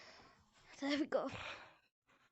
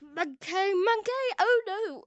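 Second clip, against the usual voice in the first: rising, louder play panic; mock panic; 4 semitones higher, 6 dB louder than usual. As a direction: cry out in pretend alarm. Play panic at monkey in game.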